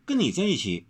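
high, tender sincerity; earnest soft-voiced. A narrator's reading. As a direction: turn serious and sweet, pitched high. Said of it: "温柔忽正色柔声地道".

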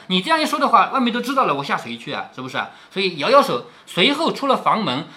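usual speaking voice

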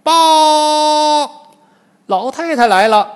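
high, loud drawn-out herald's cry; little demon announcing. A narrator's reading. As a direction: call out the news as an underling demon. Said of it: "小妖来报". (小妖高声来报)

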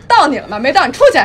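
loud, brusque, laughing Beijing nurse; blunt Beijing nurse. Retold or imitated. Beijing nurse showing how she'd dismiss the patient, loud and curt. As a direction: brush the patient off loudly. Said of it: "那你们怎么说话".

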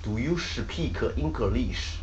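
put-on slow, syllable-by-syllable Chinese-accented English; Chinese learner's accent. Teacher imitates the worst student attempt, thick Chinese accent. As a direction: add Chinese-style vowels after consonants, heavy Chinese accent. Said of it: "这个是我听过最牛的一个".

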